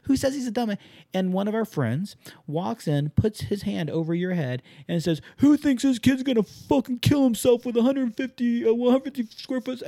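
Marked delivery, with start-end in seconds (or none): 0.0-0.8 high-pitched, excited inner triumph; thrilled, pitched way up; retold elation. Retells his own thrilled reaction. 5.4-9.8 high, loud mock public tirade; incredulous call-out to a whole room, pitched way up; berating. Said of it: "berated you publicly".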